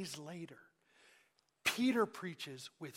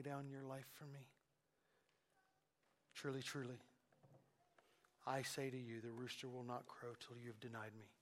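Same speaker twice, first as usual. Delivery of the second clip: knowing Jesus; 4 semitones lower, 11 dB quieter than usual. Shown as a demonstration. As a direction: ask it gently, already knowing the answer. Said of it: "the inflection of Jesus".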